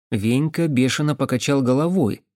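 plain reading voice

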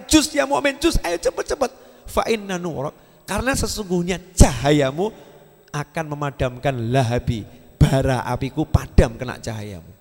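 hell urging the believer to pass quickly; frightened hell. Acted. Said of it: "nerakanya takut". (neraka ketakutan, mendesak dengan suara tinggi)

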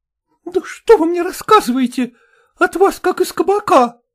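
high, scornful woman's retort; indignant accusation. A narrator's reading. Patient accuses medic of drinking.

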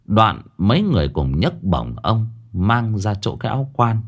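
ordinary delivery, nothing marked

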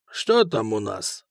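low, slow and uninterested; lazy bored drawl. A narrator's reading. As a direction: ask as if nothing matters. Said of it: "сказал тот же голос".

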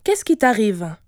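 put-on a mother puzzled by her child; puzzled surprise. A narrator's reading. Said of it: "s'étonna Kijivu". (question étonnée)

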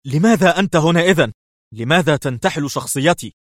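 high, loud, strained questions; tense questioning. A narrator's reading. Levi questioning the impostor, tense. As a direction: demand answers with tension in the voice. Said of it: "سأله ليفي في توتر".

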